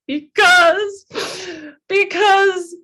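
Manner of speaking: high, breaking voice, near tears; choked-up voice climbing high and cracking; tearful retelling. Retold or imitated. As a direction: relive the sobbing answer, voice cracking high. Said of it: "I'm bawling my eyes out saying that".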